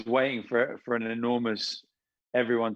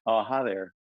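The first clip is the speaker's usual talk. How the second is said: put-on Texan accent. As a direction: pose as a polite Texan caller. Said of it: "thought that I was Dwight from Texas".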